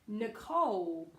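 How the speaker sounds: "Nicole" with a weak first syllable and long, strong COLE; slow model of "Nicole", landing on the second syllable; second-syllable stress. Shown as a demonstration. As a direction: hit the end of "Nicole", ni-COLE. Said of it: "stress on the second syllable".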